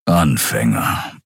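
low, slow growl of a word; a lion-man grumbling dismissively; grumbled. A narrator's reading. Keru grumbles to Nelen about the attackers, low and slow. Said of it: "brummte Keru".

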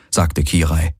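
ordinary delivery

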